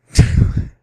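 short loud laugh; loud laugh burst. Real, not acted. Cracking up over her own accent challenge list, loud laugh. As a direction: cracks up for real, loudly.

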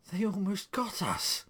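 high, loud, shaken outburst; frightened cry. A narrator's reading. Wife to husband after escaping the lions, panicked.

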